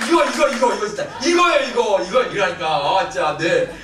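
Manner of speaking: high, loud burst of approval; real delighted excitement. Real, not acted. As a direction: shout over the cheering, thrilled that they got it. Thrilled by congregation's practice cheer. (신나서 크게 외치는 감탄)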